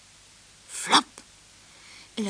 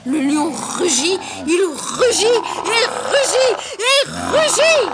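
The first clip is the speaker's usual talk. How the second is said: building from raised to very high and loud; mounting roar; 9 semitones higher, 12 dB louder, slower than usual. A narrator's reading. Narrator telling of the lion's repeated roar, building to a shout. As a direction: escalate each repeat towards a roaring shout.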